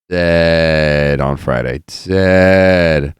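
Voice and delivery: "Dead" stretched long and loud; loud, drawn-out stress on "Dead"; emphatic DEAD. Real, not acted. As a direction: drag out "Dead" with heavy weight. Emphasises how exhausted he was.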